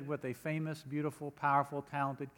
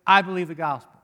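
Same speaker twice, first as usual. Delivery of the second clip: bold declaration; 2 semitones higher, 10 dB louder than usual. Acted. Repeated proclamation of faith.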